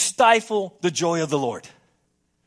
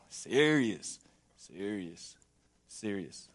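each Serious said lower and softer until it sinks away; fading serious; 11 semitones lower, 8 dB quieter, much slower than usual. Shown as a demonstration. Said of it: "we're serious".

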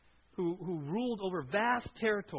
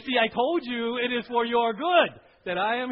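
teasing told-you-so; 2 semitones higher, 11 dB louder than usual. Pretend. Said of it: "turn to your husband and say".

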